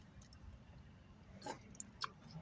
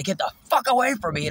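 high-pitched yell, a little restrained; reenacted scream; 8 semitones higher than usual. Re-enacting his scream at the man in a parking lot, screaming. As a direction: replay a yell to drive someone off. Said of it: "And I scream it. I gotta do it".

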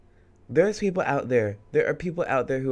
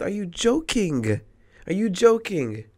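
higher, louder indignant question; outraged disbelief; 5 semitones higher, 4 dB louder than usual. Real, not acted. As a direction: demand it of her, incensed.

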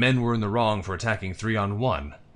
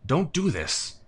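cried out; 7 semitones higher, slower than usual. A narrator's reading. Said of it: "I cried".

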